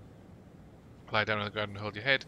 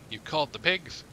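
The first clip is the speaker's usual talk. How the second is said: raised pitch, contained shout; lightly acted shout, higher pitched; mild narrated shout; 5 semitones higher than usual. A narrator's reading. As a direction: read it as an understated angry-suspect shout.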